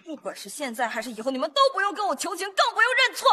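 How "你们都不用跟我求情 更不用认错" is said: sharp, rising angry declaration. Acted. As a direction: hit each word with cold fury. Heroine refuses their apologies.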